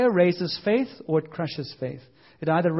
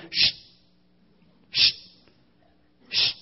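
hushing. Retold or imitated. Disciples hushing the beggar.

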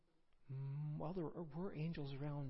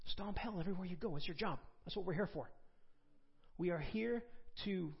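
ordinary talk, nothing marked

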